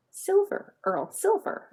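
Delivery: high, exasperated stress on each "silver"; emphatic insistence. Acted. Wife insists on the shirt colour.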